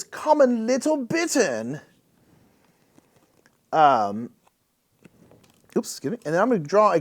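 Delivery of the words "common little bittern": higher, put-on bird expert voice; mock ornithologist. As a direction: savor the bird name in a silly accent.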